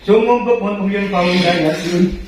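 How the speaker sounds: slow, low Arabic recitation; opening prayer before the ruqyah. Real, not acted. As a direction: recite it devoutly and unhurried. Opens with protective prayer recitation.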